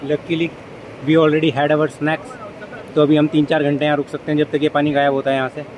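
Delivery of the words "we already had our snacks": ordinary talk, nothing marked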